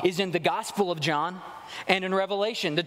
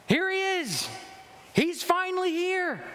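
much higher ringing shout of arrival; shouted proclamation; 11 semitones higher than usual. Shown as a demonstration. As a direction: call it out high and joyful, he has come. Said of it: "you kinda gotta shout this sucker".

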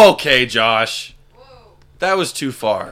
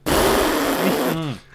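stifled giggles; 4 semitones higher than usual. Shown as a demonstration. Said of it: "or I'll do this".

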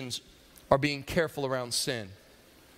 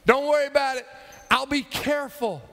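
put-on high, loud kid's reply; breezy call back; 12 semitones higher, 7 dB louder than usual. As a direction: brush off the warning from across the yard. Acts Johnny calling back to grandma.

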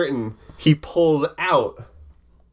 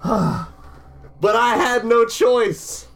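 slow, halting, higher confession; dramatic confession; 7 semitones higher, much slower than usual. Acted. The culprit confesses to the arena, slow and halting. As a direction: confess it piece by piece.

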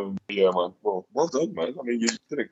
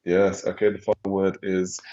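normal talking voice

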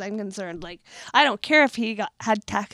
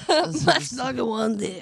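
laughing echo; slower than usual. Real, not acted.

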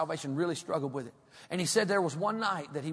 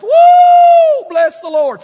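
long high whoop into loud praise; getting-happy shout; 20 dB louder, much slower than usual. Shown as a demonstration. Worshipper with raised hands getting happy, loud high whooping praise. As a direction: holler out a piercing camp-meeting shout. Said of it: "when so-and-so gets happy".

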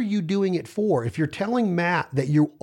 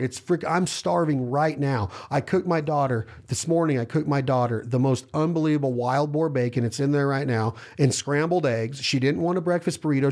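ordinary talk, nothing marked

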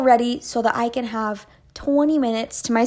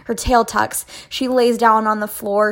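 ordinary delivery, nothing marked

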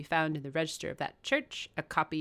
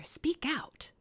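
nervous voice; 9 semitones higher, 4 dB louder than usual. Acted. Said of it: "in more articulate tones".

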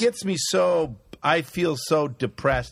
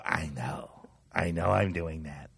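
much softer, lower, bored brush-off; quiet dismissive; 11 semitones lower, 9 dB quieter than usual. Retold or imitated. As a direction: mutter it flatly to shut them down. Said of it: "and I'll go".

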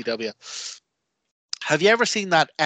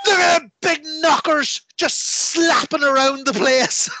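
high, loud, slow hammed-up leer; sleazy commentator; 12 semitones higher, 4 dB louder than usual. Imitating WCW commentators ogling a wrestler, loud leering yell. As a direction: bellow it like a lecherous announcer.

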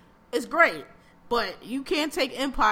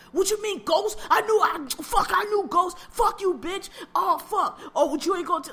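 higher, hammed-up hothead yelling curses; Tommy impression; 7 semitones higher, 3 dB louder than usual. Podcaster mocking Tommy's overacting for co-host, hammed-up yelling. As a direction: overplay the shouting thug on ten. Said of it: "Like, he's terrible".

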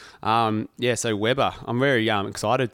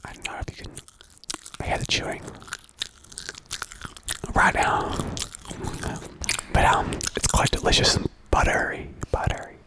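soft whispered ASMR voice; 5 dB quieter than usual. Pretend. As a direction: whisper close to the mic like an ASMR video. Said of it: "So there was the A S M R section".

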